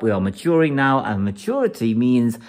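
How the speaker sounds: ordinary talk, nothing marked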